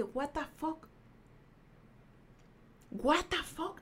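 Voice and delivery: soft retold murmur of confusion; stunned, under the voice; baffled disbelief. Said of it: "pero no por miedo, sino porque". (desconcierto en voz baja)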